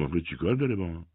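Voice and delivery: normal talking voice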